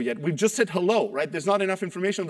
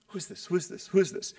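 soft repeated thought, fading in; inner wondering voice; 6 dB quieter than usual. Retold or imitated. Stranger's call makes the listener wonder, soft inner voice. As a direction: mumble it to yourself, quietly puzzled. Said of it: "what is in your mind".

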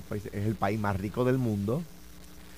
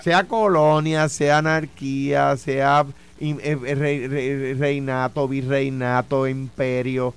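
each word hammered out louder and slow; slow emphatic list; 6 dB louder, slower than usual. Real, not acted. Panelist hammering his point to the others, loud and slow. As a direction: drive every word home, slowly.

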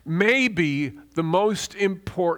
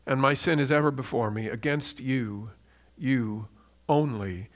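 solemn psalm read with pauses; scripture reading; 3 semitones lower than usual. Acted. Reads psalm aloud reverently.

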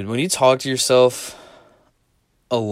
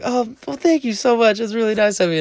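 put-on girl's voice; 13 semitones higher than usual. Girl voiced in story.

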